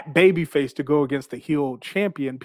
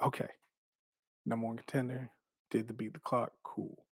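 hushed inner thought; 3 semitones lower, 15 dB quieter than usual. Retold or imitated. Quiet inner thought walking back.

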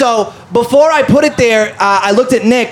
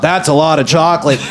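deep, lower comic catchphrase voice; comedian drop voice; 6 semitones lower than usual. Retold or imitated. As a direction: drop it like a canned radio bit. Drop clip voice quoted.

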